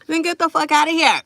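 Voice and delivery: dismissive brush-off. Real, not acted. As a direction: shoo the hater away with contempt. Telling off a hater on live.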